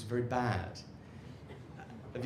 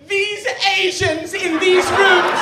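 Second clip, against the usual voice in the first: much higher and far louder, slow impassioned rant; Greta Thunberg impression; 14 dB louder, slower than usual. Greta lecturing the world's leaders, in a slow, high, shouted rant. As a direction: shriek it like a furious young activist at a summit. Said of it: "if she would say".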